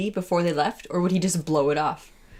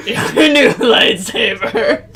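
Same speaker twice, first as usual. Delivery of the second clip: slow, higher, giggling through it; laughing; 5 semitones higher, 8 dB louder, much slower than usual. Acted. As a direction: present it while cackling. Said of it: "what voice to do for this random person".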